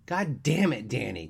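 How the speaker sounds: loud, high-pitched appalled protest; exasperated outburst. Acted. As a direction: protest loudly to stop your friend. Friend starts to drop his pants.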